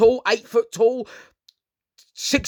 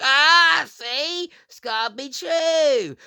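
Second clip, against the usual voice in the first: mock smug viewer; 6 semitones higher, much slower than usual. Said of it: "And then there's like".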